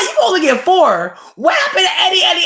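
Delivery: high, loud laughing shout of disbelief; outraged disbelief. Retold or imitated. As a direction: shriek it through laughter, outraged. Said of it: "I'm like".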